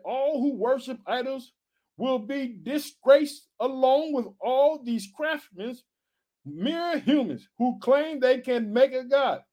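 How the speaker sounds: slow, high preacher proclamation; slow scripture reading. Acted. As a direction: draw every phrase out for the people.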